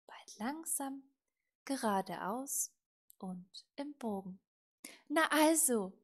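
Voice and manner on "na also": loud, higher, pleased with the result; a pleased, emphatic told-you-so; satisfied. A narrator's reading. Little witch to her tamed broom, satisfied. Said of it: "sagte die kleine Hexe zufrieden".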